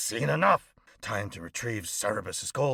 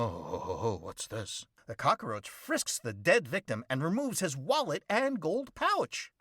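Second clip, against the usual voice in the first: ordinary delivery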